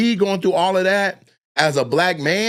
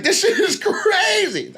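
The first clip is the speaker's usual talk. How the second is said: amazed; slower than usual. Retold or imitated. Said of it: "I'm like".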